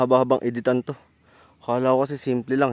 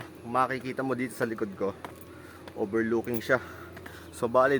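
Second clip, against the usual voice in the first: ordinary talk, nothing marked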